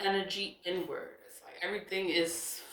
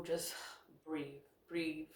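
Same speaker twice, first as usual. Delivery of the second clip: hushed and reassuring; calming; 10 dB quieter, slower than usual. Real, not acted. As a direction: ease them down, quietly. Soothing listeners after telling them calm down.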